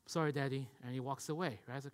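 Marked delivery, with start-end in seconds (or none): 0.1-0.7 low, subdued little apology; offhand child's apology. Retold or imitated. Son brushing off dad with a quick apology, subdued. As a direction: toss off a soft, low sorry.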